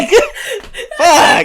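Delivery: high, laughing groan of defeat; embarrassed laughing curse. Retold or imitated. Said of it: "and I'm just like".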